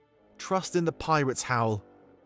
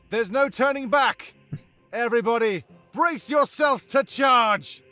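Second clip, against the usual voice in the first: raised, forceful battle cry; rally everybody for the charge, voice pitched up; calling out to troops; 14 semitones higher, 5 dB louder than usual. Acted. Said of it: "Damek calls out to everybody".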